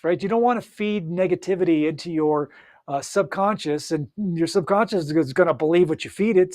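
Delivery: ordinary talk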